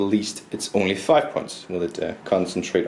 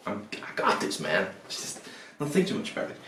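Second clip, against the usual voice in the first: hushed self pep talk; 7 dB quieter than usual. Real, not acted.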